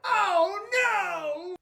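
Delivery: high, drawn-out wail of dismay; dismayed cry. Acted. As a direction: cry out as if all is lost. Son hears his toys will go.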